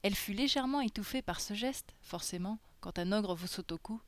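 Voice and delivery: usual speaking voice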